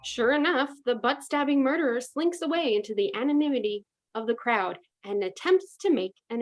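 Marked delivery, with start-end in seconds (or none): none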